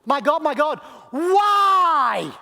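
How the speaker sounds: high, very loud cry of why; anguished cry. Acted. Jesus on the cross demanding why, anguished cry. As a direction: howl the question up at God. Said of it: "Jesus says the words".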